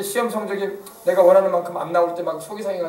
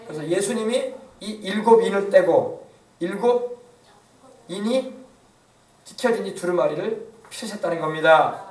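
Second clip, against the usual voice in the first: normal talking voice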